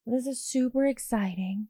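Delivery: droning monotone like Eeyore, no energy. Retold or imitated. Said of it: "so monotone and Eeyore-like".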